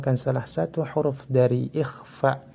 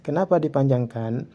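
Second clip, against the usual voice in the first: usual speaking voice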